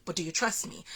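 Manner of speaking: a soft, hushed voice of God asking "trust me"; God's voice, hushed. Retold or imitated. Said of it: "hearing his voice ask me".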